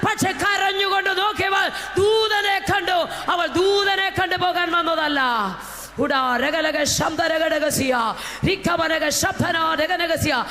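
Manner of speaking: impassioned, high-pitched sermon cry; full-force preaching. Real, not acted. Preacher urges believers not to fear the dark, full-force preaching. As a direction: preach at full force, breaking into drawn-out tongues.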